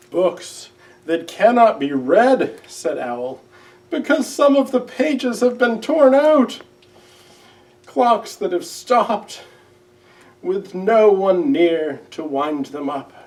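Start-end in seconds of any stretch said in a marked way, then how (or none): 0.1-2.6 tearful Owl. A narrator's reading. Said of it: "Owl was crying". 3.9-13.1 tearful Owl. A narrator's reading. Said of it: "Owl was crying".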